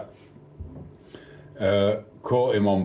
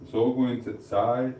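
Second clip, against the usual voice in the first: ordinary talk, nothing marked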